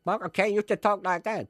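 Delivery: high, loud younger film-star impression; Michael Caine. Shown as a demonstration. Showing how the actor used to talk, high loud impression. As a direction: push it up high and loud, caricatured. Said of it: "we were talking about Michael".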